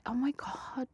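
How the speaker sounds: hushed whispered gasp; shocked whisper. Retold or imitated. Wife whispering her shock at the smoking audiobook. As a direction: whisper it in horror.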